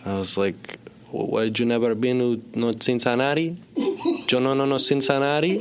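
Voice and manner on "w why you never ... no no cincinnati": high mock Belgian-Cuban accent, giggling at the end; hammed-up Belgian-Cuban accent. Son trading silly lines with his father, hammed-up Belgian-Cuban accent. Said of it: "unidentifiable Belgian-Cuban person".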